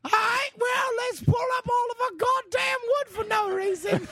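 silly squeaky mock British; hammed-up homeowner in a high squeak. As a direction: stretch it out in a put-on squeak. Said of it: "high squeaky voice convention".

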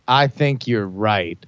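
low, slow mock sincerity; solemn concession. [name] pretends to agree with Brian, solemn. Fake agreement before shooting partner.

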